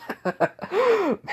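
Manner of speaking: high-pitched chuckling laugh; high, breaking-into-laughter giggle; acted laugh. Bakugo laughs to himself.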